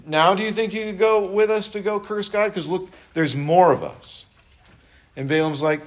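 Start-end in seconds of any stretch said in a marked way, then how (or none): 0.0-4.3 higher, pushy mock plea; mock princes pressing again; princes' pushy ask. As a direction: needle him like pushy envoys. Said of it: "they're like".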